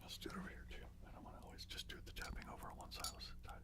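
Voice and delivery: soft unvoiced whisper, close to the mic; ASMR whisper. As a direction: breathe the words gently for the listener.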